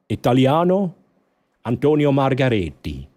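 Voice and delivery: put-on Antonio Margaretti in a light playful Italian accent; a hammed-up Italian name-drop; Italian accent, lightly put on; mock Italian. Playful Italian after French.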